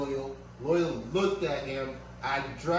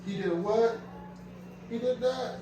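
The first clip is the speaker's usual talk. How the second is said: soothing the dog; 6 semitones higher, 5 dB quieter than usual. Real, not acted. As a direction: comfort him like a scared pet. Talking to hurt dog tenderly.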